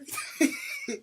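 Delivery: squealing giggle; high squealing laugh. Real, not acted. Laugh after cheer.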